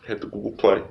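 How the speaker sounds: ordinary talk, nothing marked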